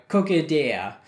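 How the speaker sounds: ordinary talk, nothing marked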